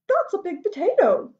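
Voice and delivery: high-pitched wife's voice; farmer's wife. Acted. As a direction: lift the pitch to play the wife. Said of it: "said the wife".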